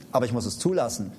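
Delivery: normal talking voice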